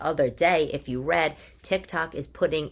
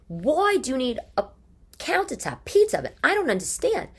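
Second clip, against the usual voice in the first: much higher, baffled outcry; incredulous; 8 semitones higher than usual. Real, not acted. Host ranting to listeners about thousand dollar pizza oven, incredulous. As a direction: sound truly baffled.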